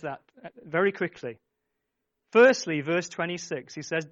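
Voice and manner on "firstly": loud burst. Real, not acted. Sudden loud burst in sermon.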